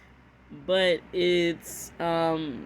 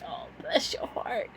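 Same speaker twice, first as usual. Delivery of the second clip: hushed, breath-only faux pity; mock sympathy; 3 semitones higher, 7 dB quieter than usual. Texan showing how locals politely insult someone, in whispered mock sympathy. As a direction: coo it under your breath, a veiled insult. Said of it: "if they say".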